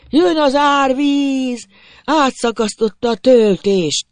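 slow, high-pitched warning cry; howled alarm. A narrator's reading. Fleeing rider warning that the dike broke, howling shout. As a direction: stretch every word into a far-carrying alarm. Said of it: "üvöltötte".

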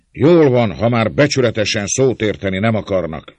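high, loud, fed-up declaration; shouting. A narrator's reading. András rolling up his sleeves, shouting. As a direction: burst out, ready for a fight. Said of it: "kiáltta felgyűrve mentéje bőujjait".

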